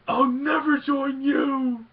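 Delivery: slow, high, very loud mock cry; anguished movie-hero yell, imitated; defiant Luke shout. Acting out Luke's defiant refusal.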